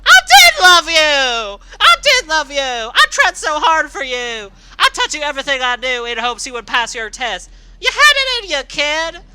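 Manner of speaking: loud, high, seething screamed rant; furious screaming. Acted. Rainbow Dash screaming back at her former little sister, seething hatred. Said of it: "as she screamed in return".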